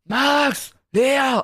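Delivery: high, drawn-out calling of names; imitated calling out. Neighbor calling his two children, imitated call. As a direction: mimic a parent calling the kids. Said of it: "irgendwann ruft er so".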